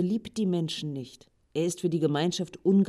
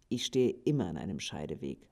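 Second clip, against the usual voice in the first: a much lower, softer voice for the Grey one; gloomy, lowered-voice answer; low brooding reply; 9 semitones lower, 4 dB quieter than usual. Acted. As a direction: answer from somewhere deep and tired.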